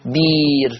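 بير said with a long plain ee, no hamza; بير with the hamza swapped for a long ee; pron bir. Shown as a demonstration. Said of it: "تقرأ بير".

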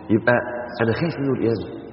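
ordinary delivery

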